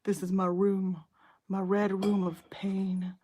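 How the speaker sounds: slow, hammed-up seductive line. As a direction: drag out the red room like a movie villain. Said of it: "Fifty Shades".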